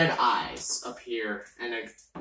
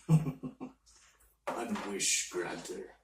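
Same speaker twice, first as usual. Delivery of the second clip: mysterious entity voice; 5 semitones lower, 6 dB quieter, much slower than usual. Acted. Entity names itself slowly.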